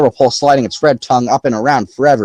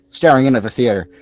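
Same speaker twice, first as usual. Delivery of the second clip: ordinary delivery, nothing marked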